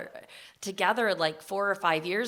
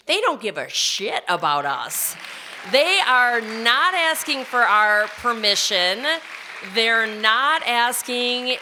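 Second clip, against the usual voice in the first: louder, slow and forceful; slow indignant protest over women's bodies; 2 semitones higher, 7 dB louder, slower than usual. Real, not acted. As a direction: hammer it home at the judges. Angry point about the judges.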